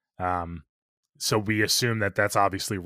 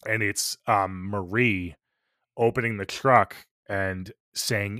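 usual speaking voice

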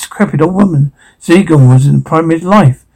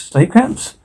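incredulous; 7 semitones higher, 4 dB quieter than usual. Acted. Incredulous echo.

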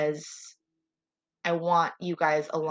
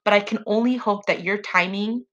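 emotional; 3 semitones higher, 8 dB louder than usual. Real, not acted. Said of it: "Ooh, I'm getting emotional".

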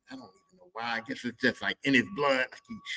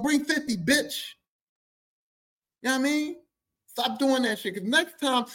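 higher, pushing the crowd; fed-up warning; 10 semitones higher than usual. Real, not acted. Comic scolding customers who shortchange his dad, heated. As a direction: scold them like cheapskates.

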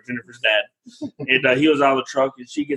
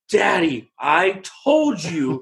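put-on slow, higher child's voice, wagging a finger; scolding toddler; 5 semitones higher, much slower than usual. Little girl scolds Daddy about the wall, slow and higher. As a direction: slowly spell out each word like a stern little girl. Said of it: "She's like".

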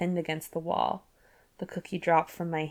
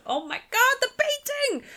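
sudden high excited squeal. Real, not acted. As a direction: pitch shoots way up in delight. Remembering a dramatic painting plot.

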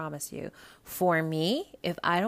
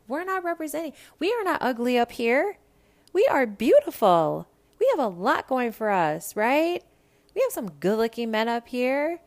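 higher and louder, defending home proudly; indignant rant; 6 semitones higher, 6 dB louder than usual. Real, not acted. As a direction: rant like your home state was insulted. Defending Midwest men, heated rant.

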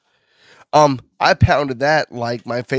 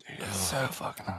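strained whisper; 15 dB quieter than usual. Real, not acted. Burning mouth from hot sauce.

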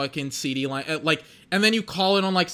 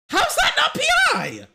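furious shout. Real, not acted. Fan yelling at the referees, furious shout. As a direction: scream it at the TV. Third repeat, full shout.

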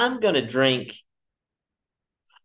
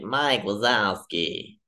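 slow, drawling bored receptionist; Monsters Inc desk lady; 3 semitones lower, much slower than usual. Retold or imitated. Desk lady calling out name, slow and bored. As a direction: drag the name out like a bored clerk. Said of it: "like the lady in Monsters, Inc".

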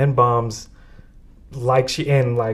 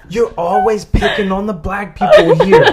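high, loud mock outburst; loud, high-pitched imitation of a complaint; retold accusation; 9 semitones higher, 7 dB louder than usual. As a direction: act out an indignant classmate, on the edge of laughter. Retelling classmate's outburst at teacher.

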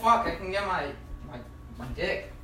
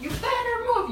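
mock yell, much higher. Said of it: "I'm yelling".

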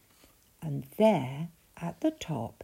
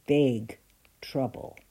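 ordinary delivery

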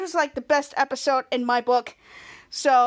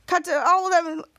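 ordinary delivery

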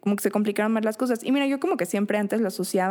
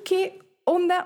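incredulous; 9 semitones higher, much slower than usual. Retold or imitated. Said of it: "Y yo así dije".

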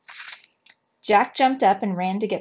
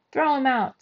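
higher-pitched heckler's demand; shouting; 5 semitones higher, 4 dB louder than usual. A narrator's reading. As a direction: call for the player to be thrown out. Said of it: "They were shouting at the Dodgers player".

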